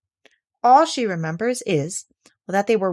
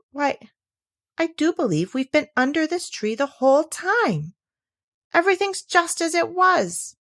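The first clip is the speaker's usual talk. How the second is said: rising, higher amazed voice; surprised; 8 semitones higher than usual. Acted. Alice looks round the tree in great surprise. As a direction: let the wonder grow. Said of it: "in great surprise".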